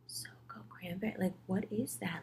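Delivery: baffled whisper. Retold or imitated. Baffled at odd drink name.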